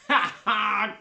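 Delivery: bubbly character giggle; gurgling laugh. A narrator's reading. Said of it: "Sheila let out a gurgling laugh".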